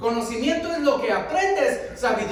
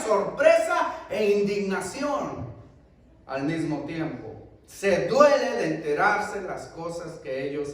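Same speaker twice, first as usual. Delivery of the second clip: usual speaking voice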